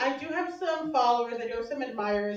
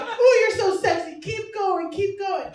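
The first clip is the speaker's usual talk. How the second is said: mock admirer; 7 semitones higher, 6 dB louder than usual. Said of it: "It's like".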